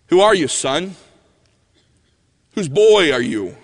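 imitated imposing preacher. Preacher questioning a fatherless boy at church door, loud and pointed. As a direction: demand it like an old-time preacher.

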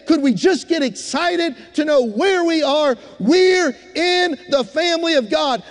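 loud, rousing preacher's cry, high and ringing; full-force preaching. Acted. Preacher rousing his congregation, loud and fervent. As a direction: lift the church to its feet with every line.

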